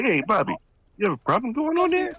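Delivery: squeaky put-on Bronx challenge; mock Bronx voice. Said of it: "I remember walking up to Bobby and say".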